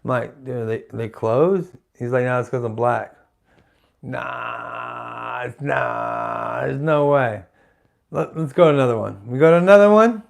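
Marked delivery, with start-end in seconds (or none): 0.4-1.7 low, hushed, hesitant question; puzzled aside. Retold or imitated. Asking homie why nobody answers the door, puzzled and hushed. 2.2-7.4 low, soft, muted reply; subdued aside. Retold or imitated. Two friends at the unanswered door, quietly subdued. Retold dejected exchange at closed door.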